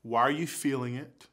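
ordinary talk, nothing marked

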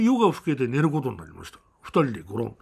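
ordinary talk, nothing marked

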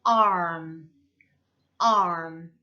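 arm with a long r-coloured vowel closing on m; curled R into M. Shown as a demonstration. Teacher showing R followed by a final consonant, drawn-out arm. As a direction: draw out arm, then close the lips on m. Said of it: "앞에 굴려줬지만 그래도 받침".

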